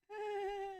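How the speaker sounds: very high, held squeal; awkward squeal. Acted. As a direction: let out a high, awkward noise. Acted reaction to misread joke.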